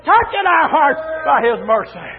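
fervent, driving church preacher; full-force preaching. Real, not acted. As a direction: preach it in rhythmic, punched phrases.

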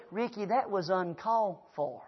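slow, hushed rebuke; stern reproof. Retold or imitated. Wife to husband after his outburst, stern reproof.

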